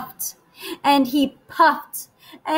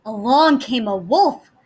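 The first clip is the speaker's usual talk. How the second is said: plain reading voice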